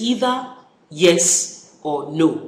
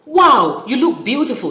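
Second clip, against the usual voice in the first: admiring exclamation; 6 semitones higher, 6 dB louder than usual. Shown as a demonstration. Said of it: "That's number four".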